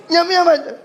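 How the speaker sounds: nasal man losing patience; impatient nasal asking. Retold or imitated. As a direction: press him for the time, nasally. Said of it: "orang bindeng tanya".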